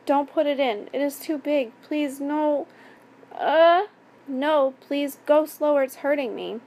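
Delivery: frightened pleading. A narrator's reading. Victim begging the attacker to stop, frightened pleading.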